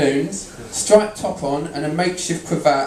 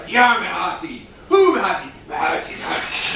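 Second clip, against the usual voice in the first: pirate voice; 3 semitones higher than usual. Shown as a demonstration. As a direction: try out the pirate voice. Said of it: "I started rehearsing".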